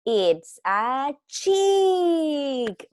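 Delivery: very slow, high-pitched answer; drawn-out reveal. Shown as a demonstration. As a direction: stretch out "chick" for small children. Teacher reveals the answer to children. (revelación lenta y alargada)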